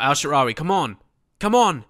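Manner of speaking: will the striker forward; urging on. Real, not acted. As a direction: come on, come on. Urging a player during an attack.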